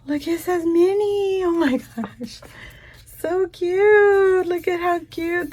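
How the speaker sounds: showing viewers cute stickers, squealing; cooing delight. Real, not acted. Gushing over Minnie stickers.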